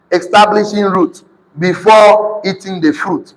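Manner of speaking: measured, emphatic repetition; slow dictation. Real, not acted. Preacher repeating the underlined words to congregation, slow and deliberate. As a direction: lay the phrase out slowly for writers.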